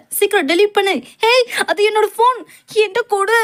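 high-pitched, agitated demand; acted high-pitched protest; urgent demand. Acted quarrel over a phone.

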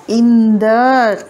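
long, loud, stretched yell of his name; furious drawn-out cry. Acted. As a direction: roar his name in outrage. (కోపంతో గట్టిగా సాగదీసిన అరుపు)

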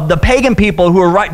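usual speaking voice

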